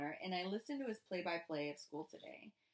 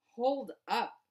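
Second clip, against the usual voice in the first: sharp interruption; 5 semitones higher, 9 dB louder than usual. Acted. Daddy interrupts.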